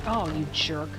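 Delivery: ordinary talk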